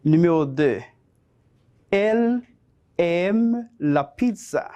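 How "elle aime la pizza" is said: measured teacher's dictation with spaced words. Shown as a demonstration. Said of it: "te voy a dictar cinco oraciones cortas".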